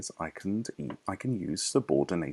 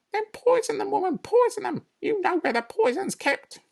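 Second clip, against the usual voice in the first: high, shrill-free bossy lady voice, much higher; Mrs Bean; 5 dB louder than usual. Acted. Farmer's wife calling down from upstairs, bossy and high.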